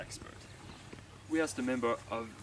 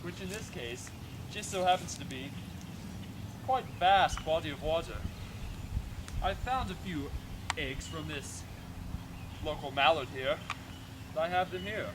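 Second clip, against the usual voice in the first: nature documentary; 3 semitones higher than usual. Acted. Documentary narration over footage.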